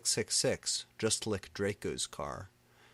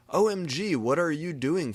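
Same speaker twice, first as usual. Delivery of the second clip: angry shout; 8 semitones higher, 7 dB louder than usual. A narrator's reading. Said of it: "he shooted angrily".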